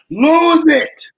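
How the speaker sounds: loud lose it; loud command. Real, not acted. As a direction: slam the order home. Preacher telling men to risk position.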